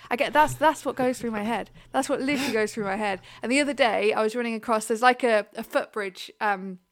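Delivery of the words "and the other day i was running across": ordinary delivery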